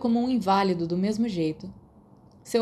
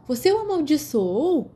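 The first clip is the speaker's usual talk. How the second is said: high, raised disbelieving question; shocked question; 8 semitones higher, 4 dB louder than usual. Acted. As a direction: ask in disbelief. James shocked at the fight.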